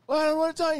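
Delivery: high, loud whiny protest; mock terror. Friend refusing on the boat, high whiny panic. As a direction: plead like you're about to drown. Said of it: "And he's like".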